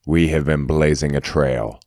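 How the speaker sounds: ordinary talk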